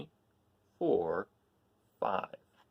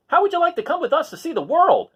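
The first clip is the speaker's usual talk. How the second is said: much higher, louder eager rooster; rooster's voice; 13 dB louder than usual. Acted. Rooster asking the cats to travel, high eager call. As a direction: chirp the invitation up high like a cocky rooster.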